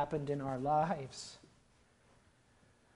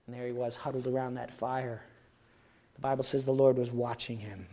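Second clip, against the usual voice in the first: ordinary delivery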